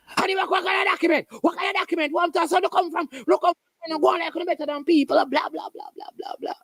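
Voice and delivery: high, fast, mocking clerk voice; sassy clerk. Clerk snapping at a returnee, high sassy mockery. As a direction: toss it off with scorn, pitched high. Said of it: "move from zero to one hundred".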